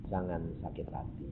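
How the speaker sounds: "Jangan sakit hati" said hushed and low; gentle God voice. Retold or imitated. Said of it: "Tuhan bilang". (Tuhan menghibur dengan lembut dan pelan)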